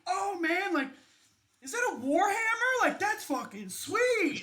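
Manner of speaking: high, loud, starstruck gush; gushing party guest. Acted. Party guest gushing over the player's armor, high and loud.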